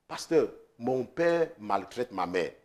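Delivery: hushed, low and slow; quiet confession. Retold or imitated. Pastor imitating a daughter confessing her father's abuse. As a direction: let it come out slowly and softly. Voicing woman's painful confession.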